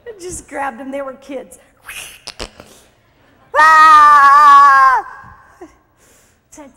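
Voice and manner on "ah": sudden shout. Acted. Shout mid-story.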